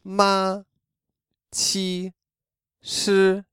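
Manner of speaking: Ma, qi, shi held high and level; high flat first tone on Ma, qi, shi. Shown as a demonstration. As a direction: keep Ma, qi, shi high and straight. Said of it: "The first tone is a highly positioned straight line".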